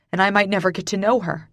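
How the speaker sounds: plain reading voice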